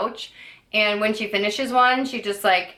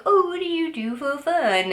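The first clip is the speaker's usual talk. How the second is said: interviewer voice; 7 semitones higher than usual. Retold or imitated. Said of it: "where they're like".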